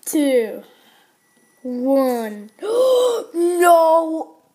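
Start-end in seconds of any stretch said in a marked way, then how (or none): 2.6-3.3 a high, loud gasp of shock; sharp gasp. Real, not acted. Gasps at the card reveal. 3.3-4.4 a loud, stunned no; shocked no. Real, not acted. Reacts to the card reveal.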